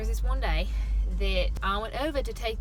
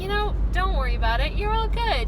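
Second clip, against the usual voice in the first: reassuring; 8 semitones higher, 6 dB louder than usual. Retold or imitated. Worker reassuring an apologetic customer.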